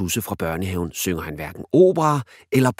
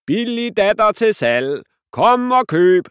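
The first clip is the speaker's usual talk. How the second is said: loud, high, slow market cry; shouting; 14 semitones higher, 9 dB louder, slower than usual. Acted. Dad teasingly selling his daughter, loud market cry. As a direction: hawk it like a market trader. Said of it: "råber han".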